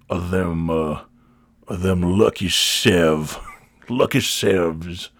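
slow, low, put-on customer voice; store customer voice. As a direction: drag out the order like a sluggish regular. Slow customer keeps ordering.

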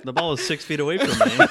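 loud protest through laughter; indignant, cracking up; laughing. Real, not acted. Defending picking up goose ball.

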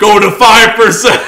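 slow, loud order through laughter; laughing mock order. Mocking the villain's order while laughing. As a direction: laugh out the cold command. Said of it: "he's like".

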